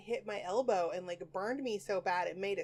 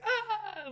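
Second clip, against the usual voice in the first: 1 mock yelp ducking; 14 semitones higher, 3 dB louder than usual. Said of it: "you have to be like".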